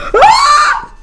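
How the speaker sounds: short high yelp of surprise; sudden shout. Real, not acted. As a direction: let out a sharp startled cry. Sudden cry. (grido acuto improvviso)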